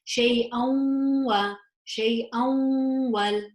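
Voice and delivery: slow, long nasal hum from shay'an into wa; idgham with gunna. Shown as a demonstration. Teacher showing idgham with gunna, shay'an held nasally into wal. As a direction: melt the n of shay'an into a held, humming w. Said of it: "I would pronounce this with idgham, with the gunna".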